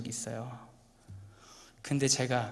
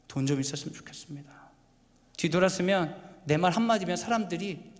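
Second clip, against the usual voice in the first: mock selfish wishing; 7 semitones higher, 8 dB louder than usual. Preacher voicing selfish wishes, building.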